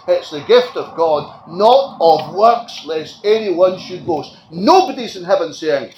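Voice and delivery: loud, measured pulpit delivery; firm sermon cadence; emphatic preaching. Acted. Preaching on grace.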